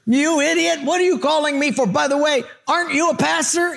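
high-pitched mock tirade; belligerent mayor. Mayor berating a new pastor, high belligerent mock yelling. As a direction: scold as a comically irate mayor. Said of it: "he's really belligerent. He's mad".

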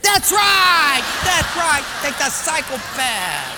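booming, high-pitched approval; personnel guy, excited. Acted. Personnel guy cheering a contestant, loud and high. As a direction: shout it like an elated quiz boss.

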